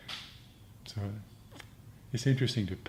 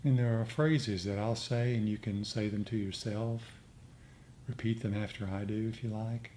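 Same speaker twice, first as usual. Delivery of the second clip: guided meditation; 5 dB quieter than usual. Acted. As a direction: lead the group gently into metta. S0 leads metta meditation.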